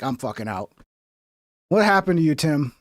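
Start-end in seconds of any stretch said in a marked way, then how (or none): 0.0-0.7 low, soft deadpan aside; muttered exit. Retold or imitated. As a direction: drop it low and dismissive. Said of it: "and then I'm like".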